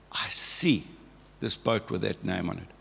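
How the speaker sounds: whispered, holding the suspense. Real, not acted. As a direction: breathe it out, building suspense. Suspense on finding the boat.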